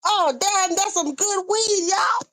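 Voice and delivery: high squeaky mock of her, rising to a shout on y'all. Mocking a woman on a live stream in a high squeal. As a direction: mimic her squealing over the weed. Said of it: "We just mocking her".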